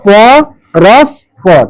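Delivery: পরস্পর split as প, রস, পর with gaps; syllable by syllable. Shown as a demonstration. Teacher breaks the word down for counting, প, রস, পর separated. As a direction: set each syllable of পরস্পর apart. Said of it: "তাহলে কি হলো".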